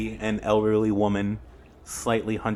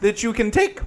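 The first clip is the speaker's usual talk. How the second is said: campy drag queen; 8 dB louder than usual. Acted. GM voices Granny Who.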